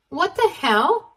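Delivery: stunned, high croak. Acted. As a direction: choke it out in shock. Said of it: "croaked out something like".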